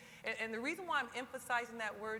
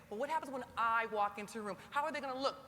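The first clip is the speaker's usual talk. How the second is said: ordinary talk, nothing marked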